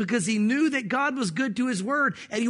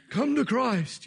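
Billy Graham impression; much slower than usual. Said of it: "he's got that older voice".

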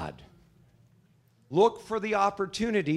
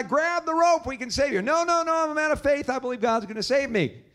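shouting over a helicopter; 10 semitones higher than usual. Retold or imitated. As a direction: yell it up over the rotor noise. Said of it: "says to the guy".

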